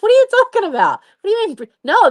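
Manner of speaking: flustered protest. Retold or imitated.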